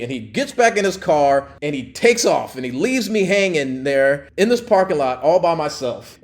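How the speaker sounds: ordinary talk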